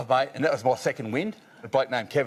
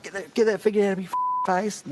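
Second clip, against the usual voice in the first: annoyed bloke; 10 semitones higher than usual. Retold or imitated. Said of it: "He's like".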